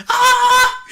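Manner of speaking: piercing high siren shriek; siren scream. Retold or imitated. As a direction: wail like an ambulance siren. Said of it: "like a fucking ambulance siren".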